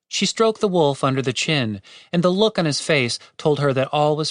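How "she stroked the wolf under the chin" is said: plain reading voice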